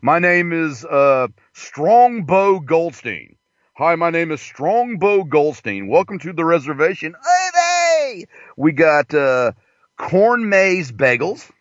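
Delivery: put-on host voice, names stretched out; casino owner character. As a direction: ham up a welcome to guests as the casino owner.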